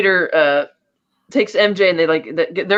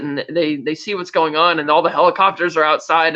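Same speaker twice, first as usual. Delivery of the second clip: ordinary delivery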